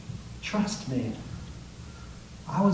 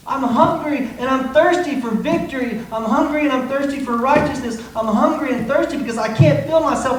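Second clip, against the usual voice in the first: higher and louder, pleading for victory; desperate hunger cry; 6 semitones higher, 6 dB louder than usual. Acted. Preacher voicing hungry believer, loud and desperate. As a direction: shout it like you're starving for it.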